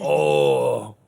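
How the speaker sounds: real drawn-out reaction to a brutal hit; loud, amused oof of sympathy; wincing reaction. Real, not acted. Sees an enemy get hit hard.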